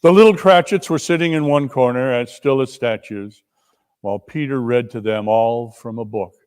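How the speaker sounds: ordinary talk, nothing marked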